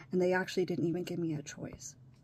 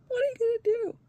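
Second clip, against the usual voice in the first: real crying; 9 dB louder than usual. Real, not acted. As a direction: voice breaking high and loud into tears. Breaking down about her situation.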